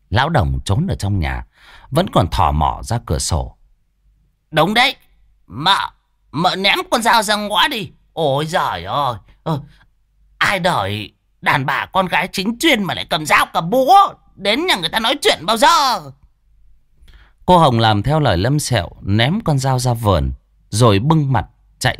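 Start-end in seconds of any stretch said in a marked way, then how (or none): 4.5-16.0 put-on much higher, fussy old man's scold. Hiding husband nagging from the window, higher. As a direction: chide her from a safe distance.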